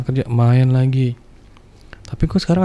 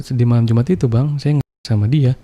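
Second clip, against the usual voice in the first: ordinary delivery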